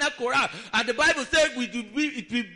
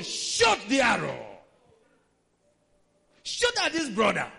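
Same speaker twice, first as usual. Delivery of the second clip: battle cry; 3 dB louder than usual. Acted. Preacher urging to resist.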